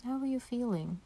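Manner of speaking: ordinary talk, nothing marked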